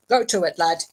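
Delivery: brisk order. A narrator's reading. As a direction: order a workman to get started. Ridcully orders the gardener to work.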